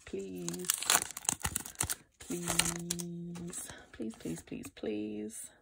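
very soft, repeated begging; hushed pleading. Real, not acted. Vlogger wishing over the last pack, hushed pleading. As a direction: beg under your breath.

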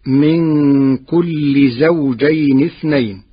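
كل read without tanween, as kuli, stretched slowly; Warsh reading without tanween. Shown as a demonstration. Teacher showing his students the reading of كل without tanween. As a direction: read كل plainly with no nunation. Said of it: "بغير تنوين".

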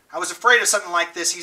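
usual speaking voice

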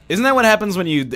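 normal talking voice

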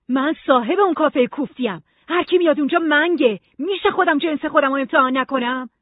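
loud, high, heated comeback; angry retort. A narrator's reading. Dan defends his drinking to Nora, loud and heated. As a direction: throw it back at her in anger. Dan snaps back in an argument.

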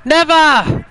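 high, loud mock defiance; taunting shout. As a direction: yell it like a cartoon villain. Taunting shout at other player.

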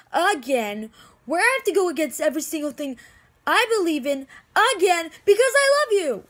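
loud, high tirade building to a peak; exasperated, heated outburst; furious blame. Acted. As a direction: hammer each reproach, climbing to a shouted confession. Acted furious blame at Damon.

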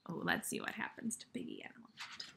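fast, hushed suspense whisper. Real, not acted. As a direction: whisper it like a secret about to unfold. Teacher building suspense before reading.

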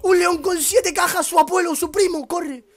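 high, loud, slow alarm; panicked shout. Real, not acted. Gamer seeing a lion with seven boxes, panicked yell. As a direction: scream at the danger coming.

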